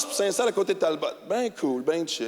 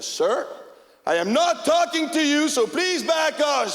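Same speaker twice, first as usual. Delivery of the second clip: higher, slow, pointed warning; stern cop warning; 7 semitones higher, 3 dB louder, slower than usual. Retold or imitated. The cop warning the hockey player to back off, stern. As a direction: lay down the law word by word.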